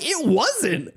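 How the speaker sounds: very high, deadpan denial; a squeaky, high correcting voice. Retold or imitated. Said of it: "Knuckles is like".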